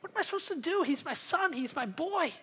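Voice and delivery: father pleading with his angry son, soft and begging. Acted. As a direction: coax him gently, almost desperate. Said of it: "he's begging his son".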